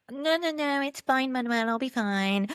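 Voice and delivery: cheerful mock reply to a worried husband; breezy dismissal. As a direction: wave off the warning lightly.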